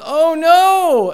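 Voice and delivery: high, loud imitated wail of dismay; child's dismayed cry. Son who just spilled his juice, imitated dismayed cry. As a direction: cry out like a little kid in trouble. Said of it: "he starts to cry, and he goes".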